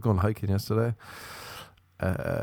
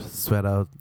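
ordinary delivery

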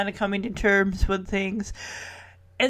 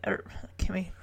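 usual speaking voice

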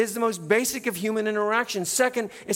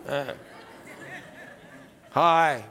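reluctant, lower-pitched mumbled "Hi"; grudging hi; 5 semitones lower, much slower than usual. Retold or imitated. Pastor mocking unwilling greeters, with a grudging lowered hi. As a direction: drag it out like you'd rather not. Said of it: "not grudging".

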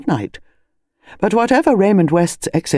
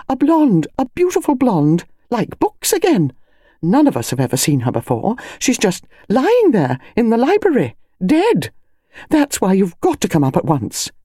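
excited lady voice; 7 semitones higher than usual. Acted. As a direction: savour the word blonde. Mrs Bantry excited.

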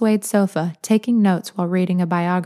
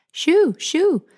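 shooing; 11 semitones higher than usual. A narrator's reading. Lil hurries Larkin upstairs.

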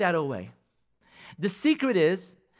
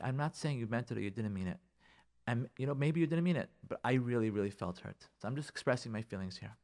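much softer, lowered voice; calm hurt repeat; 6 semitones lower, 12 dB quieter than usual. Acted. Hurt spouse repeating themselves to a defensive partner, softly. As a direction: restate the hurt quietly, without attacking.

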